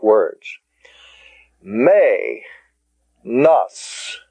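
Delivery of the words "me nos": "Me, nos" as two separate syllables, MAY ... NOSS. Shown as a demonstration. Teacher pronouncing the Greek word "Me, nos", syllable by syllable. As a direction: say "Me" like May, pause, then "nos". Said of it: "I'm going to pronounce these".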